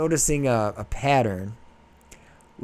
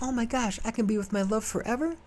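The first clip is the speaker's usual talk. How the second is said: higher, gushing mock delight; thrilled prince; 10 semitones higher, 4 dB quieter than usual. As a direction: gasp it out like a giddy lover. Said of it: "He's like".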